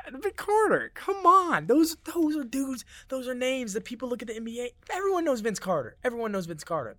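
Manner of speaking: high, strained whiny outrage; crying out over snubbed legends; mock outrage. Real, not acted. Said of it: "I'm getting really mad the more I read these".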